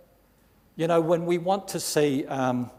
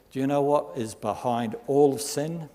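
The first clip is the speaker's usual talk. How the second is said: ordinary talk, nothing marked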